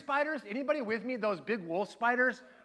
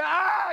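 high, loud mock scream of fright; acted-out startled "ah"; startled yelp; 9 semitones higher, 7 dB louder than usual. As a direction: yelp as if made to jump. Jumps at the kid's scream.

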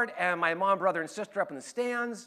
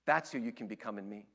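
low, soft, tender reassurance; God reassuring; 9 semitones lower, 9 dB quieter than usual. Acted. God answering a doubting believer, low and tender. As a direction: gently promise who they will become. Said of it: "And God says".

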